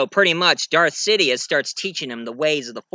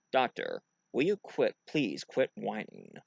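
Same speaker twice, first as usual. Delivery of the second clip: soft, low, controlled reproof; hushed deadpan officer; logical science officer; 5 semitones lower, 10 dB quieter than usual. Acted. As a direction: chide quietly, without emotion. Spock chides the doctor.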